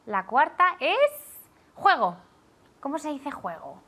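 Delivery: normal talking voice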